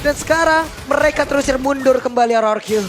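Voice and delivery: fast live play-by-play; esports caster. Acted. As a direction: call the action quickly.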